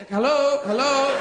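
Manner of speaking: calling out. Acted. Owner tries to get the cat's attention, calls out loudly. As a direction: call the cat sharply to get its attention.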